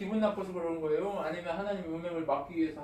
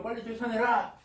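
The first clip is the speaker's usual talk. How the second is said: louder, higher preacher's command; urgent command; 4 semitones higher, 6 dB louder than usual. Retold or imitated. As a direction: order it forcefully, pitch raised. Voicing Paul's urgent command. (높고 큰 소리로 다그치는 명령)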